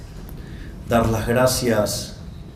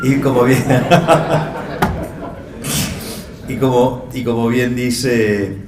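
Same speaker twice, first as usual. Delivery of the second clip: laughing; 2 semitones higher, 3 dB louder than usual. Real, not acted. Heckled as "zurdita", laughs.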